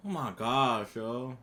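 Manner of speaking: normal talking voice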